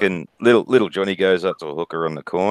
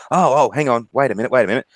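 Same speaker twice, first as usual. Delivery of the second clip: higher voice, hurried stop; acted woman interrupting; 5 semitones higher, faster than usual. Joke-teller voices hooker halting customer, higher interrupting voice. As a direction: put on a higher woman's voice to halt him.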